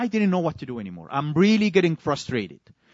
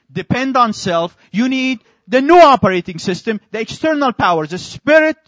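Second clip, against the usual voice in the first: preaching; 2 semitones higher, 4 dB louder than usual. Acted. Preaching new operating system.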